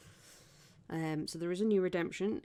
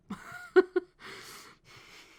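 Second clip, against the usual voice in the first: a sudden high breathy laugh; 5 dB louder than usual. Real, not acted. Laughs at own joke.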